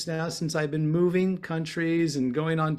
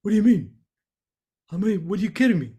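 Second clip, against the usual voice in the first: raised, punchy mock Long Island accent; Tommy Hendrickson; 5 semitones higher, 5 dB louder, much faster than usual. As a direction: imitate an over-the-top New York accent, pitched up. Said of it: "I can't do it like the way Tommy does it".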